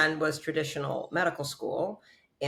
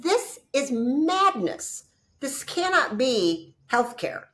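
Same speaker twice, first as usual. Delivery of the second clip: high, louder, slow indignant rant; high slow outraged condemnation; outraged protest; 8 semitones higher, 5 dB louder, slower than usual. Retold or imitated. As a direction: denounce it with rising fury. Retold outrage at healthcare.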